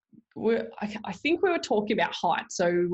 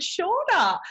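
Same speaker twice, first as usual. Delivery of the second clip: high, louder mock husband reply; teasing verdict; 12 semitones higher, 4 dB louder than usual. Husband telling wife she is shorter, gleeful tease.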